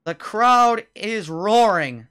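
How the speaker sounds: high, loud, drawn-out announcer call; triumphant play-by-play. Real, not acted. Announcer tells viewers of the win, triumphant commentary. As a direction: stretch it out big for the finish.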